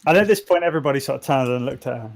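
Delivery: usual speaking voice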